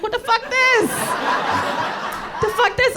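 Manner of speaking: high-pitched, incredulous outburst; hammed-up alarm, pitch jumping up. Passenger reacting to a truck swerving alongside, alarmed.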